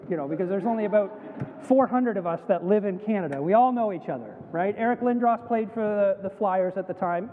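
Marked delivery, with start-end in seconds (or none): none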